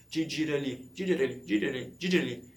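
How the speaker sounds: "dira ali" pieced together, then run into one word; repetition drill. Shown as a demonstration. Learner repeating until it matches the original audio, building-up drill. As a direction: repeat "di, dira ali", tightening it each time. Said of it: "eu comecei a repetir, repetir".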